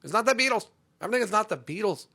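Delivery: high, loud exasperated correction; indignant correction. Acted. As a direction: snap back at the wrong guess. Indignantly corrects the quoted guess.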